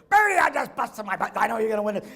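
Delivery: loud, high, excited shout; coach shouting. Retold or imitated. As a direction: bellow it to your wrestler across the gym. Coach yelling at match end.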